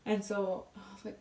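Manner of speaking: normal talking voice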